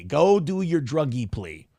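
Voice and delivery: usual speaking voice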